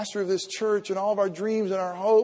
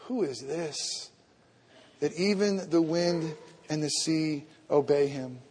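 hushed awe; 4 semitones lower, 6 dB quieter than usual. Acted. Awed disciples whisper to each other.

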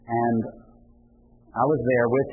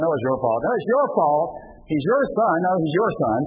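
louder, higher, heated bickering; parents' quarrel; 6 semitones higher, 4 dB louder than usual. Retold or imitated. Parent to spouse about their son, heated bickering. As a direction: throw the blame back hard.

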